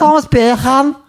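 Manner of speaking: very high, exaggerated Scouse; high-pitched Scouse send-up; Scouse accent. Shown as a demonstration. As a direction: say the name in mock Scouse. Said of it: "for a Scouse accent".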